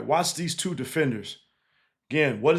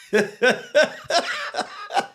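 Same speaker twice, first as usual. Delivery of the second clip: high burst of laughter; 12 semitones higher than usual. Real, not acted. Laughing at the lone fan.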